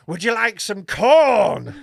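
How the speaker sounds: loud, slow mock-spooky offer; hammed-up cornfield ghoul; failed creepy actor. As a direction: draw out the last word, trying to be creepy. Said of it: "he's trying to be creepy".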